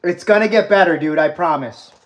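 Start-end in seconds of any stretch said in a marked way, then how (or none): none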